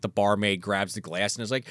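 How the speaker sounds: ordinary talk, nothing marked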